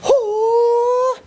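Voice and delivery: high sharp hoo cry; sharp high-pitched hoo; karate cry. Shown as a demonstration. Karate teacher's crane jump cry.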